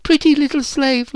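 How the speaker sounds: put-on very high, girlish teasing; laughing taunt. A narrator's reading. Virginia points at Phyllis, taunting. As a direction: tease her high-pitched, pointing the finger. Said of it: "laughed Virginia".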